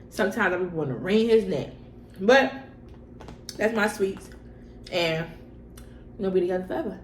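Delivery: ordinary delivery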